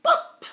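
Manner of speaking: sharp popping sound; pop. Acted. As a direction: make a loud button pop. Button pops off.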